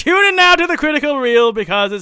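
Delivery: high, loud, hammed-up announcement; put-on spy-skit voice. Host introducing the film review in a skit, high put-on voice. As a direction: proclaim it in a silly high stage voice. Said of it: "why I'm talking like this".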